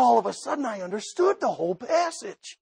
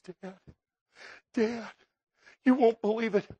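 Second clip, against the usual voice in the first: tearful son; 4 dB quieter than usual. Acted. Judah tearfully confesses to father.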